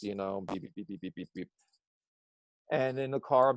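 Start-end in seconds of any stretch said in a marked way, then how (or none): 0.4-1.5 soft, muttered beep beep beep; lightly voiced beeps under the breath; quiet phone beeps. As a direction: mimic a kid hiding behind her phone beeping. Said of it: "kinda talks to you like this".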